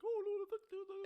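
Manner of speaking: very high, loud fretting; mock panic. Mimicking public outrage at celebrities, high mock panic. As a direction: squeal it in exaggerated alarm.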